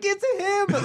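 very high, excited outburst; excited fan squeal. Retold or imitated. Fan acts out hearing his favorite rapper come on, excited. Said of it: "Like, I get so excited".

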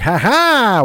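a high, loud goofy "ha ha"; mock wacky laugh. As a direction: play the silly propeller-hat clown. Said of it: "was like".

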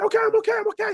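very high, squealing reassurance; retold frantic elation. Actor brushing off a worried roommate, squealing frantically. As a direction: play someone too worked up to be okay.

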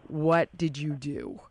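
slow, low, deliberate question; acted accusation. Giancarlo confronting her after the captain's complaint, slow low accusation.

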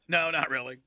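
ordinary delivery

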